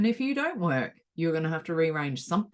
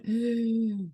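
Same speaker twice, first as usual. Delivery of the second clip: anxious gasp; 4 semitones higher than usual. Retold or imitated. Reaction to school call.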